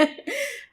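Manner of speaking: short, light chuckle; quick laugh at your own remark. Real, not acted. Amused at leaving listeners hanging, a short laugh.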